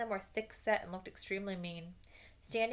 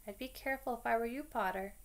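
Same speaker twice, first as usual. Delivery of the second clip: ordinary delivery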